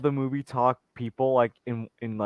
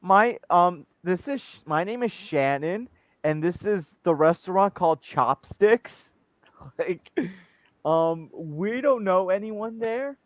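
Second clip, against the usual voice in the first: slow, higher-pitched customer-service put-on; troll the caller with a drawn-out, pitched-up greeting; restaurant phone greeter; 6 semitones higher, slower than usual. Host trolling a scam caller as a restaurant worker, slow put-on greeting. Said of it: "I'll be like".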